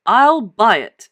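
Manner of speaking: loud, slow rich man's offer; eager cry. Acted. Rich man eagerly claims the fossil, loud and slow. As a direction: boom out the offer. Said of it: "he cried".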